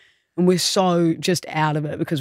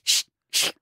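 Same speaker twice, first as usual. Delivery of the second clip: unvoiced-style hush, imitating a mum; mock shushing of a child; 3 dB quieter than usual. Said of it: "then his mum goes".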